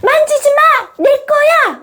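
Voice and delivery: put-on a toddler guarding a toy from sibling; angry little child. Older child warning sibling off toy, shrill yell. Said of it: "이렇게 말할 때마다".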